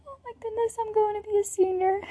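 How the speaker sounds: very high, squealed mock excitement; high-pitched teasing gush at the kindergartners; mock excited squeal. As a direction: squeal it like an overexcited little kid. Mock-excited reply to the kids.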